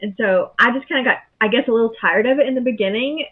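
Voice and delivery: usual speaking voice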